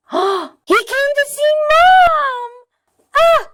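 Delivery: gasp, then cry it out in overdone anguish; hammed-up wailing. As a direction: overwrought fan wailing. Wailing over the story.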